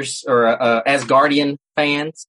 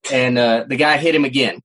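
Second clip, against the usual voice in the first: normal talking voice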